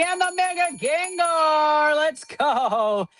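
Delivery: high, slow, drawn-out hype call; excited reveal. Real, not acted. Host revealing a rare card to stream viewers, excited and drawn out. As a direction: stretch the name out like a big reveal.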